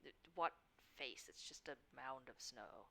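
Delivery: soft, halting disbelief sinking low; low, exasperated mutter; weary exasperation. Acted. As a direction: quietly question it, fed up. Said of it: "Briar just sighs and pinches the bridge".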